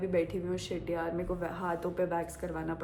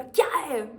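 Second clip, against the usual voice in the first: higher, much louder snap "क्या है?"; angry bark; 5 semitones higher, 9 dB louder, slower than usual. Retold or imitated. Driver snapping at girlfriend on phone, loud. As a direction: snap it like an irritated rider. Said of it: "उन्होंने बोला".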